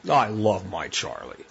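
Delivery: affectionate inflection. Retold or imitated. Owner admiring the dog lying on the porch, fond and low. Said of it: "He hears the inflection in the voice".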